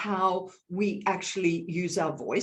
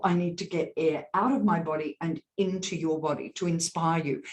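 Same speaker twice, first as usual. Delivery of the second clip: normal talking voice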